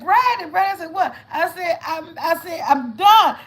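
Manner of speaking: high, loud calling out to Brandon; retold frantic call.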